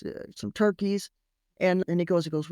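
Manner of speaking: ordinary delivery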